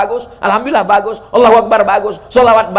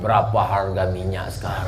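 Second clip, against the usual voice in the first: softer, lower feeble question; mock dying man; 5 semitones lower, 7 dB quieter than usual. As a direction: suara lemah orang sekarat bertanya. Dying man's silly question.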